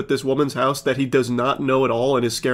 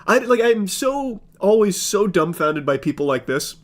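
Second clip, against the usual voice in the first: ordinary talk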